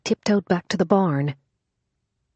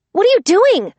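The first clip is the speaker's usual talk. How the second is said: high, loud panicked shout; 8 dB louder than usual. Acted. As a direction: scream it in alarm. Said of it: "she shouted".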